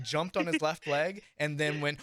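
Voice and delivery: usual speaking voice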